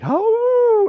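loud, high imitated howl; dog howl. Speaker imitating complaining people as dogs, howling. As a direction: wail like a hound. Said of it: "it's just like".

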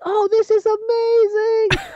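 put-on very high, slow, wavering old-lady voice; high granny voice in awe and mild fright; old grandmother voice. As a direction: squeak it out like an amazed granny afraid to fall. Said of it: "she's like".

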